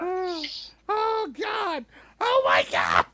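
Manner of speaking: high shocked cry of oh God; stunned outcry at the grim story; shocked exclamation. Real, not acted. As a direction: gasp it out in disbelief. Shock at killing.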